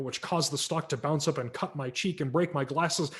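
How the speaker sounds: ordinary delivery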